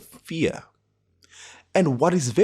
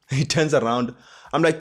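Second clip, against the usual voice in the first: ordinary talk, nothing marked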